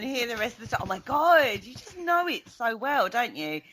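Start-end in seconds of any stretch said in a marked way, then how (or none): none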